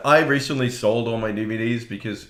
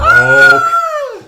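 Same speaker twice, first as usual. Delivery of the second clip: high, very loud mock scream; 14 dB louder than usual. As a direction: let out a big shrieking scream. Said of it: "everyone would go".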